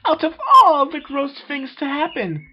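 very high, loud theatrical wail; dramatic Rarity. Acted. Rarity reprising her meme to friends, very high and loud. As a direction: play it as a grand drama queen. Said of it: "Rarity award-winning meme".

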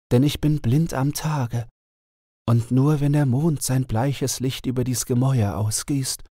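the enchanted owl telling her sad tale; owl princess voice. A narrator's reading. Owl princess continues lament. (traurige Eulenprinzessin, etwas höher)

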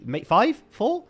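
normal talking voice

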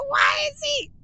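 high, squealed question through laughter; laughing disbelief. Real, not acted. Player cracking up over the impostor claim, laughing in disbelief. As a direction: barely get the question out, laughing.

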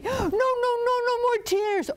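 very high, urgent string of noes; frantic protest. Acted. Girl begs the snake not to cry, high frantic protest. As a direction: cut him off in acted alarm.

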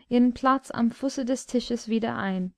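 ordinary delivery, nothing marked

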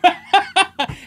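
sudden high cackle; real laugh at his own joke; high loud laugh burst. Real, not acted.

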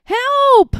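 high, loud cry for help; calling for help. A narrator's reading. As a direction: call out in playful distress. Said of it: "called the voice".

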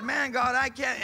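giving up on the Bible, crying out to God; frustrated cry. Retold or imitated. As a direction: throw up your hands at God, out loud.

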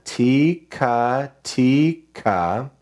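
"Tika tika" drawn out, one syllable at a time; slow, spaced-out rhythm syllables. Shown as a demonstration. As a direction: stretch each syllable as if writing it. Sounding out while drawing each note.

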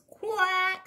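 high quacking duck voice. Acted. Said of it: "says the mother duck".

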